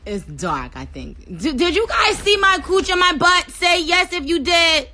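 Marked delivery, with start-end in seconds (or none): 1.3-4.9 high, loud questioning of the viewers; alarmed loud demand. Real, not acted. Streamer asking viewers what they saw, loud and alarmed. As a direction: call out urgently, pitched up high.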